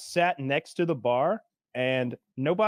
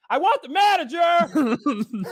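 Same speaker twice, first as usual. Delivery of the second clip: loud, high, drawn-out comic yell; demanding shout; 13 semitones higher, 6 dB louder, much slower than usual. Retold or imitated. Furious diner demanding the manager, hammed-up shout. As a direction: blow up and bellow it slowly.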